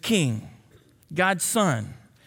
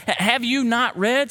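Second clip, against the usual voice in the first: raised, pointed question; sarcastic reading; 5 semitones higher, 3 dB louder than usual. Shown as a demonstration. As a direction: needle them with the question. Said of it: "I'm gonna read it sarcastically".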